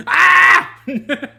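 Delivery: sudden high, loud scream with a laugh; laughing fake shriek; mock panicked scream. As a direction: yelp as if your beard caught fire. Imagines beard on fire.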